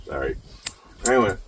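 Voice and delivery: usual speaking voice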